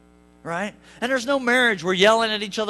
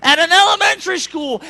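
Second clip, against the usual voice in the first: usual speaking voice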